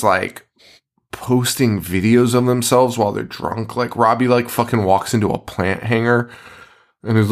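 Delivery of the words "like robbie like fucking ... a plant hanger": normal talking voice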